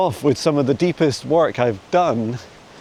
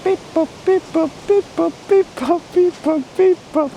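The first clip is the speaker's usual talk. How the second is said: robot beeps; slower than usual. Pretend. Playful robot beeps between questions.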